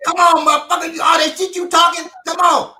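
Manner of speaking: high, loud fight dare; shouted challenge. Retold or imitated. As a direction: goad him into a fight. Dude challenging father to fight.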